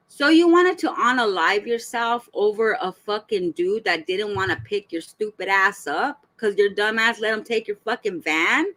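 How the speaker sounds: usual speaking voice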